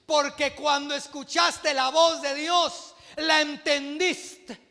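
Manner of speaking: loud, slow, emphatic preaching; fiery preaching. Real, not acted. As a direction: drive each phrase home to the congregation. Preaches at full force.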